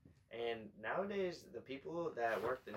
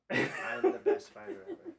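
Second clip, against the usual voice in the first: cocky brag; 6 dB louder than usual. Pretend. Cocky brag, much higher.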